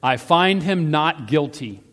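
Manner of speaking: loud, slow, emphatic verdict; firm declaration. A narrator's reading. Pilate declaring a verdict to the Judeans, loud and deliberate. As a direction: declare it out loud, weighing each word. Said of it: "and he declared".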